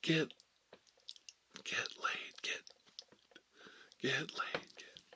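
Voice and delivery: crowd chant done under the breath; imitated yell, kept very quiet; hushed mock yell. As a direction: half-whisper a party chant. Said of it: "you're supposed to yell".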